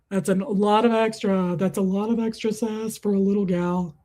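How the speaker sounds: put-on doting, teasing voice; cooing mock interview with a pet; pet-talk voice. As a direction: tease a tiny dog adoringly. Teases her dog in put-on voice.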